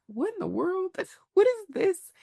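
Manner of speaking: high, giggly, delighted disbelief; giggly little girl. Retold or imitated. Little girl giggling at her grown-up self. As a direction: squeal it like a tickled little girl. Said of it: "just giggly".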